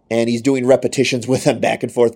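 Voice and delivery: usual speaking voice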